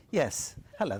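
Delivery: ordinary delivery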